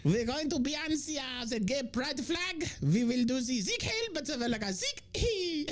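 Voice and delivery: high, camp German soldier; mock German accent. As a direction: announce it in a hammed-up German accent. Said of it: "I know that was German".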